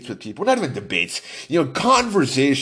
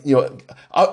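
ordinary talk, nothing marked

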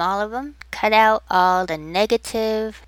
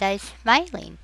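ordinary talk, nothing marked

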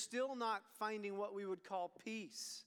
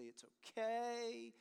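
soothing dad; much slower than usual. Retold or imitated. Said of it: "I would say".

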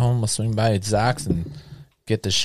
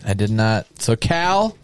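ordinary talk, nothing marked

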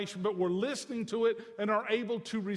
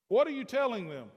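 usual speaking voice

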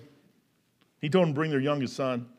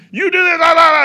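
much louder, higher hammed-up scolding; mock scolding; 12 semitones higher, 13 dB louder than usual. Joseph's stern words to his brothers, as a hammed-up loud scolding. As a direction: bark it like a mean ruler, half in jest.

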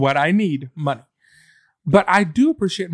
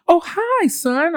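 put-on high sweet old-lady greeting; 13 semitones higher, much slower than usual. Retelling the Walmart lady greeting her, high and sweet. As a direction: imitate a tiny old woman. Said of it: "and she goes".

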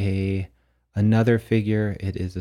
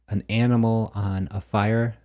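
ordinary delivery

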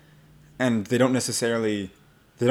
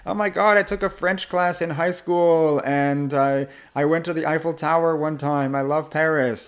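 high-pitched, over-eager small talk; mock an enthusiastic Francophile, pitched up; hammed-up gushing to a foreigner; gushing new acquaintance; 6 semitones higher, 3 dB louder than usual. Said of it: "having the same conversation over and over again".

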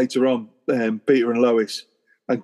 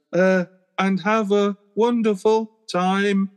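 Lois's mic line; 7 semitones higher, slower than usual. Retold or imitated. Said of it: "Lois picks up the microphone and goes".

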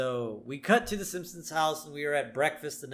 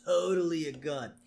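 lightly voiced surfer dude, higher; hint of a laid-back stoner; Snake voice; 4 semitones higher, 3 dB louder, slower than usual. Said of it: "Snake's telling him".

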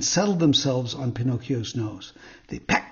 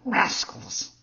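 indignant fox; 7 semitones higher, 4 dB quieter than usual. A narrator's reading. Fox condemns the assassins.

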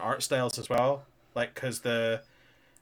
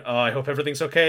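mock nervous; 5 dB louder, much faster than usual. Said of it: "him going".

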